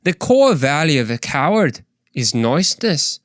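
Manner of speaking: a light Scottish accent. Shown as a demonstration. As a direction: say it with a Scottish lilt. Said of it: "let's try a little Scottish".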